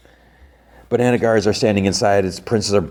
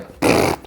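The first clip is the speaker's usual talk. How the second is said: short imitated raspberry; raspberry noise, no voice; blown raspberry. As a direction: blow a quick raspberry. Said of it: "blows a raspberry".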